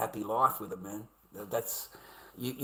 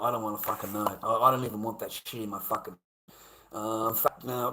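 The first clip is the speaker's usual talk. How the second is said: ordinary talk, nothing marked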